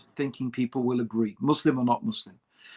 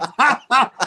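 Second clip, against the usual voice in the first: high, loud burst of laughter; 8 semitones higher, 10 dB louder than usual. Real, not acted. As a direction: laugh out loud at your own joke. Laughs at own mock accusation.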